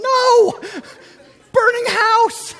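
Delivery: very high, very loud yell, slow on burning house; exasperated shouting at the police officer. Retold or imitated. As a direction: scream the obvious at him. Yelling at confused cop.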